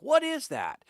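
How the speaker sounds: startled high "What is that?"; sudden puzzled exclaim; surprised. Retold or imitated. Seeing strange tree far off.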